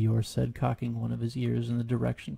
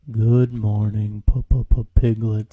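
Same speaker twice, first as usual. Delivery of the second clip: slow, low, louder mopey drawl; gloomy donkey; 3 semitones lower, 9 dB louder, much slower than usual. Acted. Eeyore greeting Piglet in the bog, slow and gloomy.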